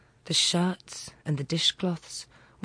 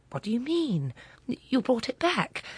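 bewildered; 7 semitones higher than usual. Acted. Friend confused.

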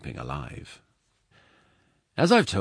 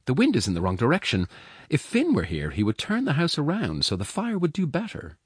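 woman's voice climbing higher, loud; complaining foster mother; 9 semitones higher, 6 dB louder than usual. Acted. Foster mother grumbling about the fire, pitch climbing.

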